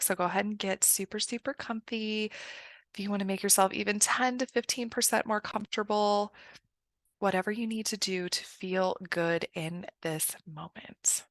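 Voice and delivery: soft, unhurried meditation voice with long pauses; guided meditation. Acted. Coach guiding listeners into a visualization, soft guided meditation.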